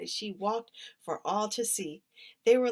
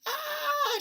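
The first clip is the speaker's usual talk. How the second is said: very high, sharp yelp of a fall; cry of alarm; 4 dB louder than usual. A narrator's reading. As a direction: yelp as your feet fly out. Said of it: "she cried out".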